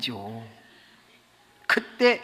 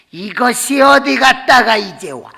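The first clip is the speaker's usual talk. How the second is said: higher, louder angry yell at the wife; angry husband; 7 semitones higher, 9 dB louder than usual. Retold or imitated. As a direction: bark at her like a drunk husband. Drunk husband yells at wife.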